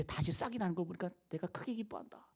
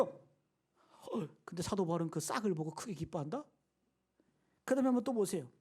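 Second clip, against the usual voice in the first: ordinary talk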